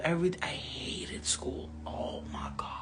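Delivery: unvoiced, very soft whisper. Real, not acted. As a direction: let it drop to a hushed whisper. Hated school.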